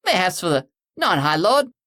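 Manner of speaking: cockney jab. Acted. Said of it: "some cockney jackass".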